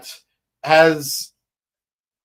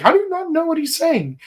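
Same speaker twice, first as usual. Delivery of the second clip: high-pitched, quick incredulous question; mock disbelief; 12 semitones higher, faster than usual. Fan scolding confused viewers over the Liverpool accent, mock disbelief. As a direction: blurt it out fast, pitched way up.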